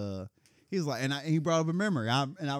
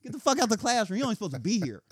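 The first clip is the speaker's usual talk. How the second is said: higher, louder mock yell; mock angry husband; 7 semitones higher, 5 dB louder than usual. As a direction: play the angry husband shouting at kids. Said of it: "What, what can he say?".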